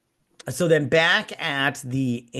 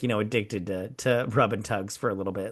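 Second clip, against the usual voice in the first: ordinary talk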